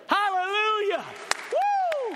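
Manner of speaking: high, loud cry of praise; joyful shout. Real, not acted. Preacher whooping for joy at the news, loud and high. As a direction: let the hallelujah ring out.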